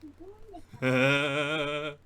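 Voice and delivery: long guilty laugh. Real, not acted. Game master laughing at the players' damage, guilty laughter. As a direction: laugh when you really shouldn't. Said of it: "Sorry, I shouldn't be laughing".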